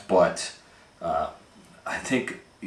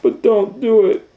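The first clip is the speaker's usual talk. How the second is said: put-on very high, loud child plea; children begging parents not to; pleading child voice; 8 dB louder than usual. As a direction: squeak it out like a begging kid. Children protesting in story.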